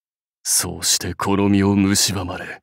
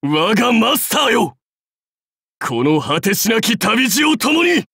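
high, impassioned warlord's cry; rallying shout; 12 semitones higher than usual. Acted. Warlord calling his master to the journey, rallying shout. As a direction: call out at full voice, pitched far up.

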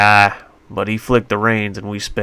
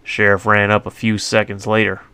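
usual speaking voice

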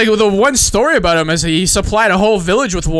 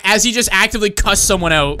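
usual speaking voice

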